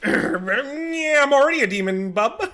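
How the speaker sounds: high, loud, laughing tycoon bluster; oil tycoon voice. Retold or imitated. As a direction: play a cartoon oil baron, half laughing. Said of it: "he talks like an oil tycoon".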